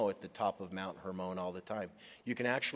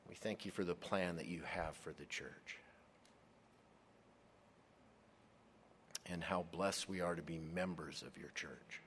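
prayer; 4 semitones lower, 9 dB quieter than usual. Real, not acted. Pastor opening the study, soft prayerful voice. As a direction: pray quietly over the study.

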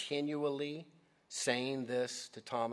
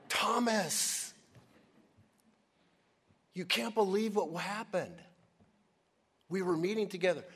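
higher and louder, breathless with news, stressing saw; excited disciples; 7 semitones higher, 5 dB louder than usual. Acted. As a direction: tell him eagerly, insisting you saw him. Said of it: "can you see how excited they are".

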